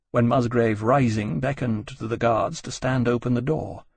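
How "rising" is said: ordinary delivery, nothing marked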